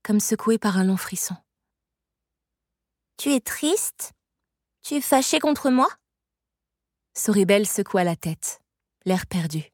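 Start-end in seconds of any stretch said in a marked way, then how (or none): 3.2-5.9 put-on high-pitched, questioning child voice; worried little girl. A narrator's reading. Little girl asking if Soribel is cross, high child voice. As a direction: ask as a small girl, pitched up.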